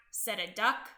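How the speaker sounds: ordinary delivery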